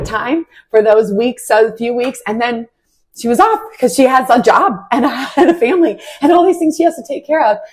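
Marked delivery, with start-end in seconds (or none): 3.2-7.6 laughing through the words, pitched up; a real laugh spilling into the sentence; giggling while she explains why her friend left. Real, not acted.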